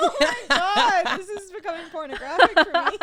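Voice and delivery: laughing loud and high; cracking up while talking. Real, not acted. Laughing at the cowboy roleplay.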